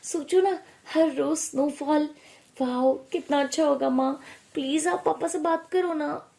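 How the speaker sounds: high-pitched eager daughter pleading with her mother; dreamy young daughter. Acted. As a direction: voice an excited daughter coaxing mum.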